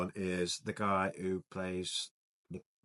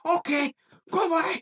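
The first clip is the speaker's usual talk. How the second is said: high, loud mock rage yell; raging Scarface hero; 9 dB louder, slower than usual. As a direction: bark it out like a furious gangster hitting his rage meter. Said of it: "he gets, like, a rage meter and he goes".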